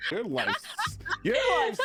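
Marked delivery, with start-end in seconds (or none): 1.2-1.8 much higher, louder shocked cry; shocked exclamation. Real, not acted. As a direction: burst out in disbelief at the news. Startled reaction to allergy news.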